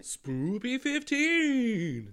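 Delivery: slow, high sing-song "Spoopy fifteen"; cartoonish promo chant; drawn-out high echo. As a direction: stretch the promo code out high. Echoing promo code, drawn out.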